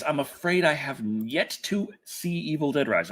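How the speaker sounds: usual speaking voice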